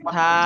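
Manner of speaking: সা said gently with a soft lisped th. Shown as a demonstration. Teacher showing students the soft Arabic letter, gently. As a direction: breathe সা out lightly and softly. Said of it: "খুব আলতো ভাবে, একদম নরম ভাবে".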